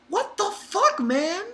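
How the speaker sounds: very high-pitched incredulity; exasperated disbelief. Acted. Reader reacting to Cohen's anger, incredulous. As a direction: protest it high, baffled and fed up.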